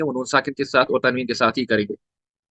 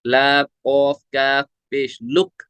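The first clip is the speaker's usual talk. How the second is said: slow spelling out; much slower than usual. Shown as a demonstration. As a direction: spell जबर, नख, लाम slowly. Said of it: "हिज्जे करने आपको". (धीरे हिज्जे करना)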